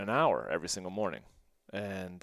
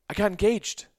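much higher and louder announcement; excited blurt; 12 semitones higher, 9 dB louder than usual. Retold or imitated. Son blurting his engagement to his mother, excited. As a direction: burst out the big news.

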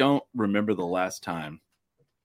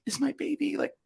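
mock fretting mom. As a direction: play the fretful mom in a high, thin-volume falsetto. Imagining anxious mom on night out.

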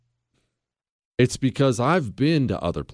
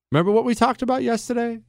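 ordinary delivery

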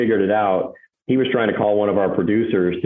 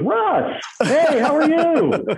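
very high, slow gushing greeting; overexcited caller; much slower than usual. Retold or imitated. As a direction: stretch the hello with joy. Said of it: "He goes".

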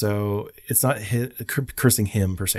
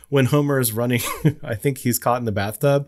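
ordinary delivery

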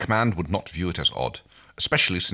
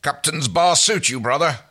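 teasing; 9 semitones higher, 4 dB louder than usual. Acted. Brother teasing with a grin.

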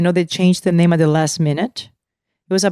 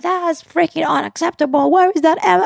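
high-pitched mock-angry outburst; retold outrage; 10 semitones higher than usual. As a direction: imitate someone pissed off, voice jumping up high. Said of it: "He was so freaking pissed".

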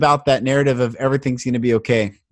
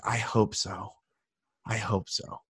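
much softer, fading to almost nothing; fragile murmur; 7 semitones lower, 12 dB quieter than usual. Retold or imitated. Cashier answering a stranger's comfort, faint fading murmur. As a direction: let the hope barely come out. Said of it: "She's like".

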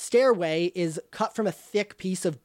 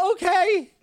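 higher, agreeable NPC voice; Andera voiced; 10 semitones higher than usual. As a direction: play Andera giving in. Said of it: "And she says".

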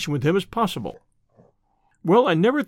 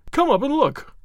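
yelled; 7 semitones higher than usual. Acted. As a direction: shout the order in panic. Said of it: "he yelled".

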